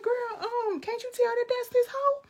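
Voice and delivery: very high, loud disbelief at the screen; high-pitched outraged yelling; exasperated screech. Retold or imitated. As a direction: shriek at her to wake up.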